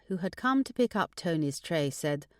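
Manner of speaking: usual speaking voice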